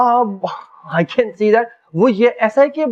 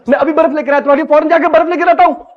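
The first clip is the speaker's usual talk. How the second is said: higher, fast panicky reassurance; flustered promise; 5 semitones higher, 4 dB louder, faster than usual. Acted. Husband hurrying off for his hurt wife's ice, flustered. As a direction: promise help in a rush, in a fluster.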